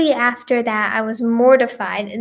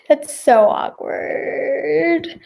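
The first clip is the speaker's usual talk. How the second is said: laughing drawl; 3 semitones higher, much slower than usual. Real, not acted. Laughing at an awkward story.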